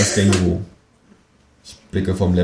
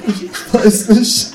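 high, drawn-out desperate wail; anguished; 6 dB louder, much slower than usual. Acted. Narrator tormented by puns, high anguished denial. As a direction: stretch it out in mock agony. Narrator cracking under pun torture.

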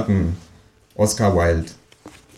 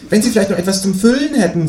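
much higher cheeky kangaroo voice; 12 semitones higher than usual. Acted. Kangaroo asking the narrator for eggs, much higher voice. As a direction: play the new neighbour kangaroo, pitched far up. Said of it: "sagt das Känguru".

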